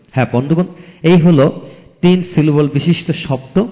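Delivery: ordinary talk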